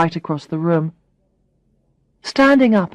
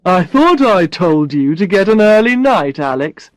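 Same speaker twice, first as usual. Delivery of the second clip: a hint of a teasing boss voice; teasing boss, lightly voiced; lightly voiced Brad; 4 dB louder than usual. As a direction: boss gently scolding his assistant. Brad speaking to Alex.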